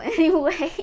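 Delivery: ordinary talk, nothing marked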